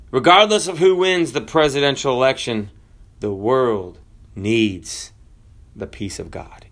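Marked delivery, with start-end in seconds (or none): 3.2-6.7 slow solemn. Acted. Solemn slowed emphasis.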